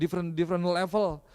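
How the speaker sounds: ordinary talk, nothing marked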